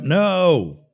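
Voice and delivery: high, loud drawn-out refusal; yelling back. Acted. Stranded man refusing the helicopter overhead, yelling back. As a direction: yell it up to the sky. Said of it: "Yells back up".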